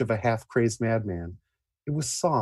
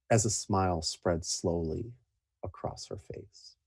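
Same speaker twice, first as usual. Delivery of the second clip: normal talking voice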